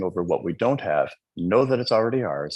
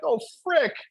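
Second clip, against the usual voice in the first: high, whiny grumble at the dogs; playful high-pitched griping; mock exasperation; 8 semitones higher than usual. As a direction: complain like a put-upon dog owner. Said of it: "I'm like".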